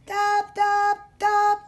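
high, evenly spaced taps; croquet knock sounds. A narrator's reading. Narrator mimics dragons playing croquet, high even taps. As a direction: knock out each tap on a high note.

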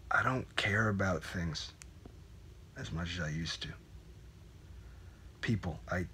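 very soft, halting, near a whisper; barely voiced, haltingly admitting it; hushed confession. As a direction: confess it quietly, with long hesitations.

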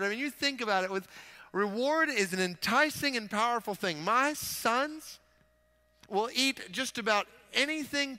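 high, loud pulpit fervour; impassioned preaching. Real, not acted. Preacher telling the church God's word is a delight, impassioned preaching. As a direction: preach it with soaring, punched key words.

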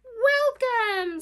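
high, loud squawked greeting; squawking heron. A narrator's reading. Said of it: "squawked Heron".

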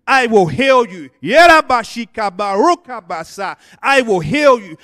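booming preacher voice, every line hammered out; preaching at full force. Acted. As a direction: thunder God's promise to the congregation.

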